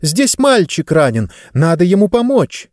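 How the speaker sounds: lightly voiced young girl, high and pressing; urgent girl's plea. A narrator's reading. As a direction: push him to help the hurt boy. Girl urging father to help. (девочка настойчиво просит отца помочь)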